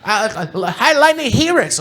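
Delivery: high, loud excited kid player impression; [name]'s voice. [name] answering what he's doing, high excited impression. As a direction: bounce it out like an eager Magic kid. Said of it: "[name], what are you doing right now".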